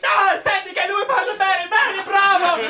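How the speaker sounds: mock overjoyed Italian cheering; exaggerated Italian delight; excited Italians. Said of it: "they're like".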